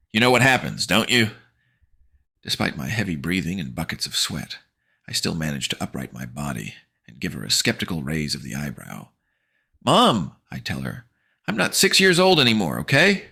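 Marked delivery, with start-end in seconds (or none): 0.1-1.3 teasing threat. Acted. 9.9-13.3 put-on high, louder annoyed teen; exasperated.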